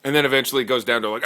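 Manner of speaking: ordinary talk, nothing marked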